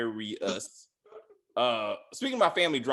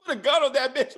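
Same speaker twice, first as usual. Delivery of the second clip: higher, louder, laughing through the words; laughing retell; 14 semitones higher, 8 dB louder than usual. Retold or imitated. Cohost laughing while recalling the gun threat, loud and amused. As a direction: giggle out the threat in disbelief.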